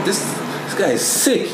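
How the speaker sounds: ordinary delivery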